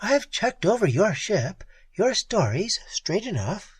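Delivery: a hint of the cool Red Peri; lightly voiced Peri. A narrator's reading. Peri voiced higher than narration.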